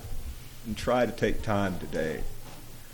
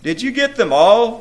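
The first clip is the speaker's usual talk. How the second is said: high, loud demanding roar; 14 semitones higher, 14 dB louder than usual. A narrator's reading. Demon prince questions his imps, roaring. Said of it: "roared the prince from his throne".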